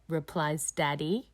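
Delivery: plain reading voice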